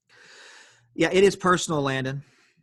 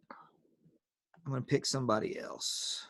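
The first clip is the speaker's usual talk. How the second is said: whisper; 10 dB quieter, slower than usual. Real, not acted. Teacher's aside during online class.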